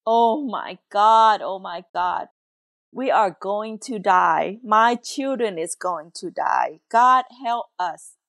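ordinary talk

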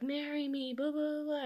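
high, singsong mock pleading; dismissive imitation of a tiresome suitor; mocking retelling. Mocks his repeated proposal.

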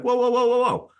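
high, rising calls of whoa to stop her walking off; mock startled call. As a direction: call her back, half alarmed. Calling someone back, retold.

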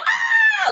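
very high mimicked squeal; playful mock shriek, not full volume; mocking scream. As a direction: poke fun at screaming fans. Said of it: "She just made fun of us".

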